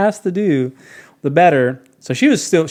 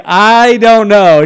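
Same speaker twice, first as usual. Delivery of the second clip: slow, louder, higher drawn-out answer; slow unsure kid; 6 semitones higher, 11 dB louder, much slower than usual. Retold or imitated. Boy answers the ranger, slow and drawn out. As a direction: stretch it out like a clueless kid.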